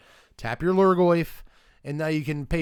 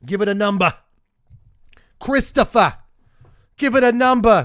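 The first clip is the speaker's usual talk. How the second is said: loud mob boss bark, much higher and louder; Sopranos impression; 8 semitones higher, 12 dB louder than usual. Streamer demanding a score from chat, loud mobster voice. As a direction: play a shouting New Jersey mobster. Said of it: "That's my, uh, Sopranos impression".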